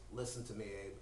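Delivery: low, quiet voice of God; stern God. Acted. God calling Abraham to listen, low and stern. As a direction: drop low and soft to admonish.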